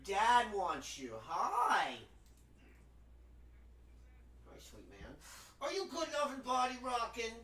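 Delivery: quiet, sing-song pet talk; hushed cooing to a pet. Real, not acted. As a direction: sweet-talk the little pet.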